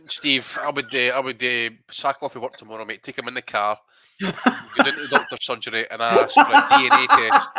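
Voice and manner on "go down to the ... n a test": loud, higher, laughing through the joke; laughing while joking. Real, not acted. To Steve about his son, laughing loud. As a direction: laugh it out big.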